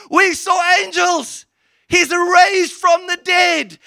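high, slow, thrilled cry; joyful high proclaiming; excited announcement. Acted. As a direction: announce it like breathless good news. Women excitedly report resurrection.

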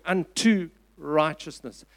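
slow, deliberate weight on "unto"; stress on UNTO. Shown as a demonstration. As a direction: stretch out "unto" before "righteousness". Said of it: "I want you to stress that word".

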